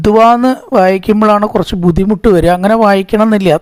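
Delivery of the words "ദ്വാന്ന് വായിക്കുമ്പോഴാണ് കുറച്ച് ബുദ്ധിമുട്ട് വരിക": normal talking voice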